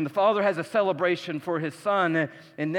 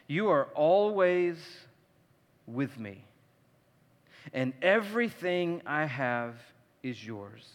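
tender father; 6 semitones lower, 6 dB quieter, slower than usual. Retold or imitated. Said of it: "And the father said".

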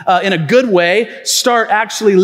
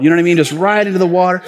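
ordinary delivery

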